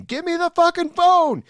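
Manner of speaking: high, loud imitated scream; shrieked mock demand. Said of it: "was rushing back inside Boston screaming".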